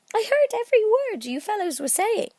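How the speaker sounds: plain reading voice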